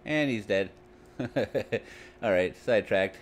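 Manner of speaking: ordinary talk, nothing marked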